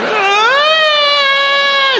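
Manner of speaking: high shocked scream; teacher's shriek. Retold or imitated. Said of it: "She was like".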